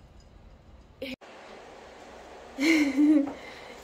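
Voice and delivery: long rising laugh; a long real laugh. Real, not acted. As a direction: laugh freely at the pet. Laughing at the pet.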